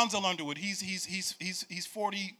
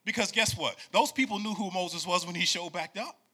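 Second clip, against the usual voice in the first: rising, louder preacher cadence, almost singing; full-force preaching; 6 semitones higher, 6 dB louder than usual. Acted. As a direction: build the sermon to a shout.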